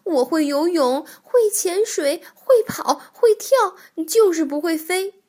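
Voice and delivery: high, loud, eager bragging that keeps climbing; excited shouting. A narrator's reading. 跳跳对白鹳博士急着炫耀本领，兴奋大喊. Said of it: "跳跳兴奋地嚷道".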